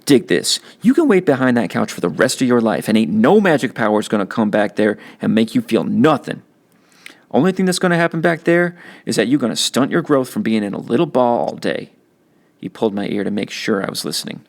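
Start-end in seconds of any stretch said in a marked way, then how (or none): 0.0-11.9 put-on older brother's streetwise lecture, a bit higher and livelier; big brother setting him straight; teen big brother. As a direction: talk down to your kid brother, tough but caring. Byron lecturing his little brother.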